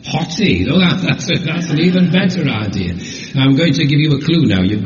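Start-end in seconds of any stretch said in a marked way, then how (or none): 0.1-0.7 Hottie said hot-tee, high and cheeky; guessed reading. Shown as a demonstration. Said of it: "You tell me".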